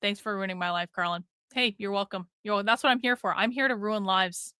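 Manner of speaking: ordinary delivery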